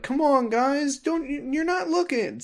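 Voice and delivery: high-pitched mock exasperated plea. Roxas pleading with friends who aren't looking, mock exasperated. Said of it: "like, exasperated".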